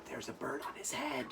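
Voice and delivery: hushed, secret-telling whisper. Acted. Teacher whispering the surprise to children.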